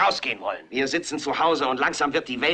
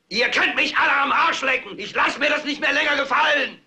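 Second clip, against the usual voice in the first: higher, furious yell out the window; shouted defiance; 8 semitones higher than usual. Acted. News anchor to his TV audience, modelling the window yell, shouted. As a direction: bellow it like you've had enough. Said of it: "den Kopf raussteckt und schreit".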